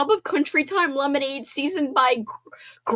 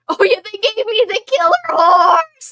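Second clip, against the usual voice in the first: mock scream; 10 semitones higher, 10 dB louder than usual. Streamer reenacting her favorite movie scream for chat, hammed-up loud yell. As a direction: belt the line out as a hammed-up scream. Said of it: "I also like to scream".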